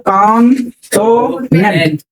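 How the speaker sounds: Consonant slowly split into three beats; broken into syllables. Shown as a demonstration. Teacher showing students the three syllables, consonant slowly broken up. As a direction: break consonant into three drawn-out parts. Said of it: "कितनी बार break होना पड़ा".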